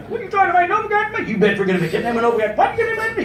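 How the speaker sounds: fast, high-pitched protest; hammed-up panicky squawk; mock agitated reply. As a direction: sputter questions at breakneck speed. Acted confused reply to Puritans' offer.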